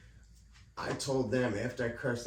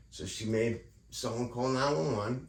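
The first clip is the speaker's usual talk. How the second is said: normal talking voice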